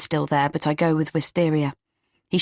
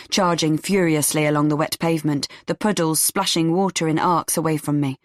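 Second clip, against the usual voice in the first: ordinary delivery, nothing marked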